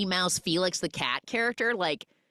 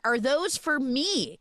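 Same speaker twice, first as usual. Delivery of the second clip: mild mock call, pitched up; imitated yell; 8 semitones higher, slower than usual. Boy calling to girl carrying flowers, mild high mock yell. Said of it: "he yells to her".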